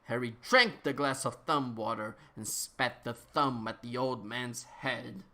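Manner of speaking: usual speaking voice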